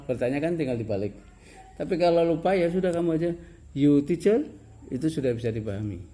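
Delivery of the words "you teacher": "You teacher" loud, rising high on "teacher"; question intonation. Shown as a demonstration. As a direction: ask "You teacher" with tone alone. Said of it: "hanya nadanya nada bertanya".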